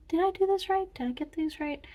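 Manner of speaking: high-pitched acted questioning; mock self-checking student. Student just checking finished work, high-pitched mock questioning. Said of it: "now you're just checking".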